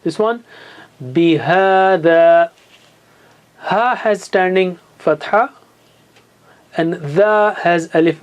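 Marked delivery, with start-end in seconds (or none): 1.0-2.5 "bihada" with both long a's stretched equally; stretched vowels. Shown as a demonstration. Teacher demonstrating for students, "bihada" stretched slowly. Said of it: "You got to stretch them equally".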